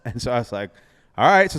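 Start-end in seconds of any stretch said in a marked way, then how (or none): 1.2-1.5 loud eager all right; psyched-up go-for-it; pumped agreement; excited. Retold or imitated. Agreeing to the crazy plan.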